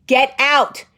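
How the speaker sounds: retold yell. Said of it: "in the middle of me yelling".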